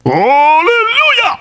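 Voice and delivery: slow, high, loud drawn-out "Hallelujah". Retold or imitated. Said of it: "Kulakasan pa".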